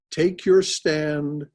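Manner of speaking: slow, emphatic verse declaiming; solemn recitation. Acted. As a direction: proclaim the verse firmly.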